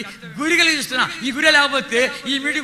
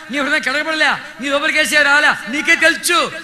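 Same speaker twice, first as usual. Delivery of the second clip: fiery preaching; 5 semitones higher, 7 dB louder than usual. Acted. Preacher urging the congregation to repent, fiery preaching. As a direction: preach it out to the crowd, full force.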